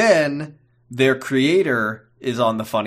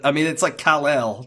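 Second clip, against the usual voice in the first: ordinary talk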